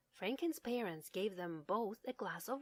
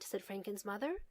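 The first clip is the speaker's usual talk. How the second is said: usual speaking voice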